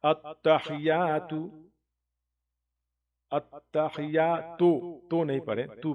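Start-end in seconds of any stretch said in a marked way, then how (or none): 0.0-4.6 التحیات with a thick, throaty ح; heavy ha. Shown as a demonstration. Teacher shows the heavy ha in التحیات. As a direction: darken the ha in التحیات. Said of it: "ہا کو یہاں پہ تھوڑی موٹی آواز پڑھیں".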